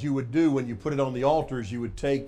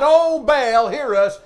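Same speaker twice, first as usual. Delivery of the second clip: raised, high imitated cry; 10 semitones higher, 12 dB louder, slower than usual. Preacher quoting the prophets' all-day plea, crying out. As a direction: call out as the pleading prophets. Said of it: "the Bible says they cried".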